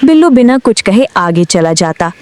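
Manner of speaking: usual speaking voice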